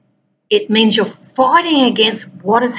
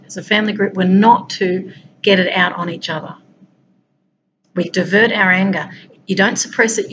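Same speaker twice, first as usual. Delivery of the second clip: ordinary talk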